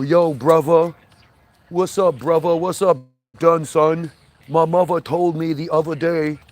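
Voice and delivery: loud, hammed-up New York slang greeting; mock New York street talk. Comic mocking New Yorkers' slang, loud hammed-up New York voice. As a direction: bark it out like a mocked New York guy on the corner. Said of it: "there's the New York part".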